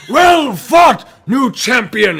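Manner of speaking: high, loud congratulation; hearty bookie shout. Acted. Bookie handing gold to the champion, hearty shout. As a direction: bellow it like an arena announcer.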